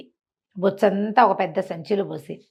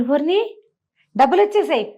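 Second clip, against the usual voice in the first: higher, louder cry of wonder; amazed; 7 semitones higher, 3 dB louder than usual. A narrator's reading. Boy amazed at the money he got, surprised exclamation. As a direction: gasp it out in amazement. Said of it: "ఆశ్చర్యపడి".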